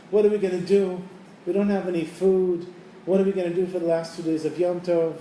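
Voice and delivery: put-on crying children calling out. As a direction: plead like a frightened child. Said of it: "the kids are screaming and they're crying".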